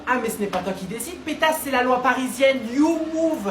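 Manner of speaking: a Parisian losing it at tourists; furious insult. Acted. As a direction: scream the insult at them.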